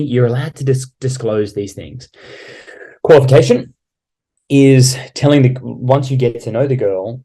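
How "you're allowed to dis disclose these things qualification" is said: normal talking voice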